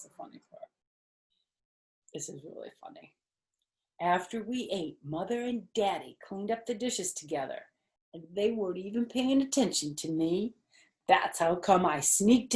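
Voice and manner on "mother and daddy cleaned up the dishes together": usual speaking voice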